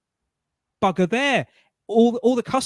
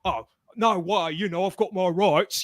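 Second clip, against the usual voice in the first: mock indignant protest. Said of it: "I didn't go".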